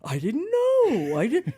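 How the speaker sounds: slow, drawn-out mock innocent denial; mimicking a husband feigning innocence; playing dumb. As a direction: stretch it out like you have no idea. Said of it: "He always acts dumb".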